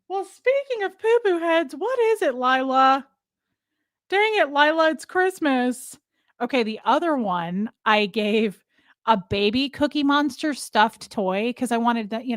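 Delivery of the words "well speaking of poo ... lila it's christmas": high, playful scolding of a pet; pet-talk voice. Acted. Scolding her pet Lila in a high cutesy voice. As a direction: coo a mock telling-off up high.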